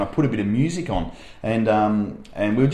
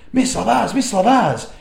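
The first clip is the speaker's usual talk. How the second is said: put-on excited little kid; 9 semitones higher, 3 dB louder, slower than usual. Kid imitated running up excitedly.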